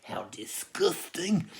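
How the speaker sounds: mock-disgusted whisper, drawn out; sarcastic whisper. Vlogger mocking people who want to save the planet, sarcastic whisper. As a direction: hiss it with fake contempt, slowly. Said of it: "I wanted to be a smart".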